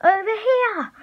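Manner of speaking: very high, urgent attention call; shouting. A narrator's reading. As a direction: yell high to draw the chaser over. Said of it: "Ben shouted".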